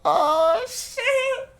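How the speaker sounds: very high squealed shock; shocked shriek. Real, not acted. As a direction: shriek it in disbelief. Shocked reaction.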